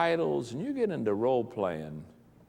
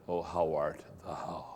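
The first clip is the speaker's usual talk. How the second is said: slow, low whispered pulpit voice; hammed-up solemn preacher whisper; mock preacher's voice; 6 semitones lower, 8 dB quieter, slower than usual. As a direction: put on a pious hushed preacher tone. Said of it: "you'll get a preacher's voice".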